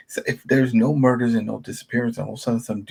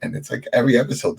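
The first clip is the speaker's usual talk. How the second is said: usual speaking voice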